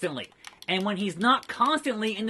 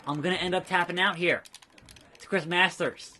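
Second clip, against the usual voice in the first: normal talking voice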